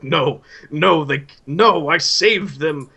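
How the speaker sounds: high, loud, desperate insistence; frantic madman denial. Acted. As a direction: insist like a man clinging to madness. Vago interrupts Magus, denying.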